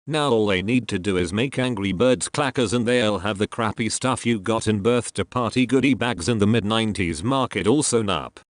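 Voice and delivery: usual speaking voice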